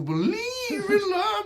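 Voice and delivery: Cher-style auto-tune wail. Shown as a demonstration. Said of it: "the auto-tune".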